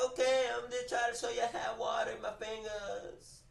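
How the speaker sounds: put-on high yelling boy defending his powers; shouting kid. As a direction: yell back like a wounded kid. Kid self shouting back to grandma.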